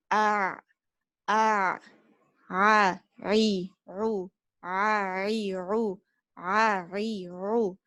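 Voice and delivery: "A, ri, ru" with the middle throat squeezed; Ain drill. Shown as a demonstration. Teacher drilling the Ain letter for students, squeezed throat. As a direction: squeeze the throat muscle on each "a". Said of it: "squeeze the little muscle in our throat".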